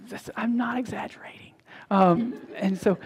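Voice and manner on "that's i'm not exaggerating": whispered aside. Real, not acted. Pastor whispering to the congregation about his daughter, hushed. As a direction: whisper it to the room.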